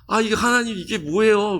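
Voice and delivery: high, exasperated cry to God; exasperated complaint. Retold or imitated. Moses protesting to God, high exasperated cry. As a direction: throw up your hands, why God.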